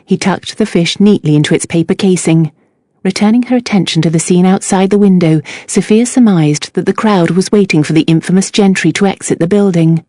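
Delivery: ordinary delivery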